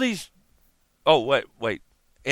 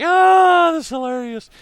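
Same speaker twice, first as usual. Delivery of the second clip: much higher, loud burst of glee; delighted shout; 11 dB louder than usual. Retold or imitated. Reacting to Freemasons rejecting the rapper, gleeful loud shout. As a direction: crow with gleeful amusement. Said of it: "And it's like".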